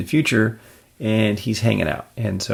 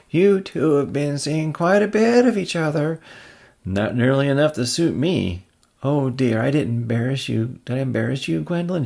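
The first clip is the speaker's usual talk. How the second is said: put-on higher, fond old-woman voice; sweet old aunt; 6 semitones higher, 4 dB louder than usual. Aunt May cooing at Peter in an old-woman voice. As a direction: coo at your nephew.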